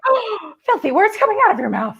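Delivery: high, laughing mock outrage; mock shock. Fan mock-scandalised by the sweet actress swearing, laughing high. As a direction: squeal it out, half laughing, pretending to be scandalised. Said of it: "Suddenly I'm like".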